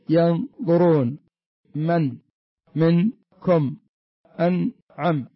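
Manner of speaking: slow, separated words, each ن clearly sounded as in "منكم"; إظهار النون. Shown as a demonstration. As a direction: stop the tongue on ن in "ينظرون" and "أنعم". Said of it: "يعني يظهر ال-، توقف اللسان مع الحنك". (نطق "منكم" و"أنعم" ببطء مع إظهار النون)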